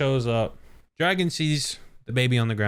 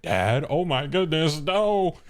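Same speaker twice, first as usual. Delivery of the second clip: mock distressed wail, climbing higher; Dragon; 6 semitones higher, slower than usual. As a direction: cry out in hammed-up dismay. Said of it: "You got Dragon. He's like".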